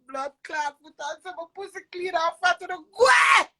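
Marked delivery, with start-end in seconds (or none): 2.4-3.5 shouted out, much higher and louder; sudden shout. Real, not acted. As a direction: yell it out hard. Sudden outburst mid-rant.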